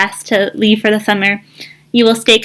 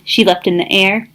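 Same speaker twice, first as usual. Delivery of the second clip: ordinary delivery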